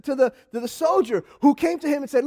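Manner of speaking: ordinary talk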